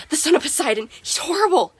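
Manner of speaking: high-pitched narrated panic; terrified. A narrator's reading. Chris glimpses Percy and panics, terrified. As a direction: cry it out in strangled fright. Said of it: "made a strangled, terrified sound".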